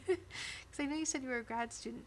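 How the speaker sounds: ordinary talk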